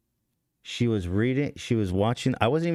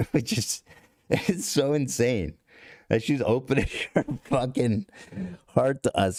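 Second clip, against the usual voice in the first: laughing through words; 5 semitones higher than usual. Real, not acted. Laughing while retelling the poem.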